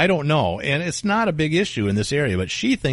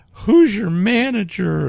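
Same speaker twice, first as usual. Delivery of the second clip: mock entitled demand; 8 semitones higher, 4 dB louder, slower than usual. Lady demanding the manager.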